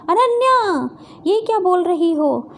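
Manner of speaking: high cry on the name; indignant outburst, easing into the question; shocked protest. Acted. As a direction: call out the name in disbelief. Falsely accused, protests to accuser.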